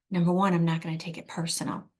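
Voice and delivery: usual speaking voice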